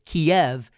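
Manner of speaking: Kiev in two syllables, "kee-EV"; Russian pronunciation. Shown as a demonstration. Host explains the backstory, Kiev said "kee-EV". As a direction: say Kiev ending like "Bev". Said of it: "is a Russian pronunciation".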